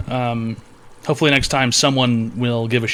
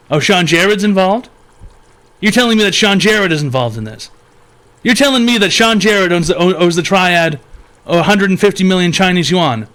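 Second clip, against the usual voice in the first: higher, louder outraged disbelief; mock outrage; 6 semitones higher, 5 dB louder than usual. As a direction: act scandalized at the news. Host outraged at debt news.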